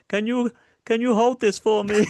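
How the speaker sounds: hammed-up polite old man; mock innocent. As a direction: play it innocent and helpless. Strongman asks innocently.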